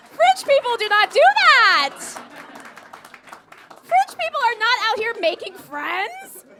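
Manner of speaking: slow, high, very loud protest; outraged shouting. Acted. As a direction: shout each word in comic outrage. Outraged disbelief at French friend.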